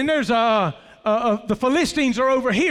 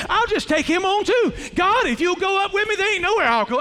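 fearless fervent prayer; 6 semitones higher than usual. Acted. David's fearless prayer, preached.

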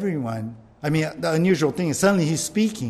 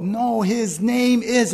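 acted slow, drawn-out words, pitched up; laboured mouthing; 6 semitones higher, slower than usual. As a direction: stretch each word as if struggling to form it. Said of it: "he's mouthing the word like".

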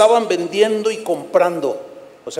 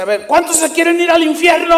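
high, loud preacher's hype call; rousing trick question; 11 semitones higher, 7 dB louder than usual. Retold or imitated. The preacher tricking the shouting crowd with a high, loud question. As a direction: bait the crowd into cheering. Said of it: "les decía".

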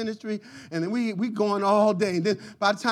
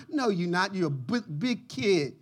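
ordinary talk, nothing marked

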